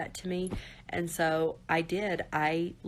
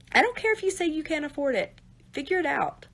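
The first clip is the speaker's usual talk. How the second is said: insistent urging; 9 semitones higher, 4 dB louder than usual. Real, not acted. Urging viewers into counseling.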